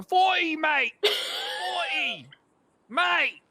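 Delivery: high, loud cockney shouting, cracking at the top; mock British yelling. Officer imitating the suspect's British shouting for the judge. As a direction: screech it like a wild London drunk. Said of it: "other British terms".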